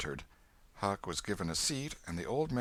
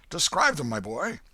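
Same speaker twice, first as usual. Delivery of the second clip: higher and louder, insistent; more urgent demand; 7 semitones higher, 7 dB louder than usual. A narrator's reading. Old man pressing the boy again, higher and louder. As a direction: push harder, almost shouting.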